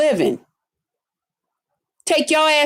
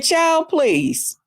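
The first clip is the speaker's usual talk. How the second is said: higher, sassy brush-off; dismissive; 6 semitones higher than usual. Retold or imitated. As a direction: wave it away with sass. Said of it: "I said".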